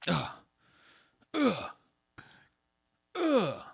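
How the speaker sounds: high-pitched frustrated ugh; short squealed groan of exasperation; exasperated groan. Real, not acted. Frustrated at being interrupted.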